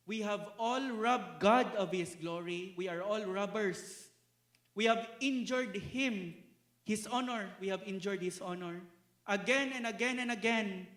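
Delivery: loud, rising preacher's build; forceful preaching. Acted. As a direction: drive the charge home to the congregation.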